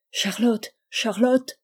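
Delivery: rising desperate calling of a name. Acted. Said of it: "l'appel désespéré d'Emmanuel".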